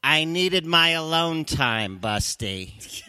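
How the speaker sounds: high, slow, loud mock wife; defiant wife. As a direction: throw it back at him, drawing it out. Wife retorts to yelling husband.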